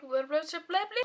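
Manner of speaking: high playful rising blahs; sing-song blah. Real, not acted. Vlogger goofing off at sign-off, sing-song babble. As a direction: babble it up in a silly lilt.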